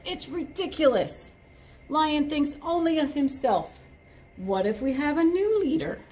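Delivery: monkey voice. Acted. Monkey protests in story.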